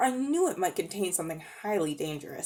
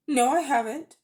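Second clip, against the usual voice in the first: indignant; 6 semitones higher, 5 dB louder than usual. A narrator's reading. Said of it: "said Diggory indign-, indignantly".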